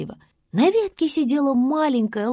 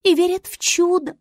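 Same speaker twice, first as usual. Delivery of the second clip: very high little voice; high-pitched star creature; 11 semitones higher than usual. Acted. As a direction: retort in a tiny high voice. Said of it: "сказала звезда".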